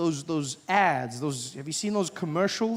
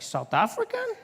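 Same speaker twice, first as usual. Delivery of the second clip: very high, puzzled question; incredulous echo; 14 semitones higher than usual. Retold or imitated. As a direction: echo it in utter disbelief. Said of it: "He's like".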